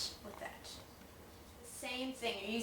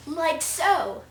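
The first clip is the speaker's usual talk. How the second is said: huge fiery dragon roar; 6 semitones higher, 9 dB louder than usual. Shown as a demonstration. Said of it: "how to do the dragon noise".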